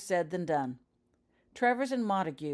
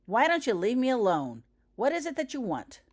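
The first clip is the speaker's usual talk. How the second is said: loud, high, hostile burst; Kenny bursting out at Vernon, loud and hostile; hostile outburst; 6 semitones higher, 6 dB louder than usual. A narrator's reading. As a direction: snap it out, fed up and hurt. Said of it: "Kenny burst out hostily".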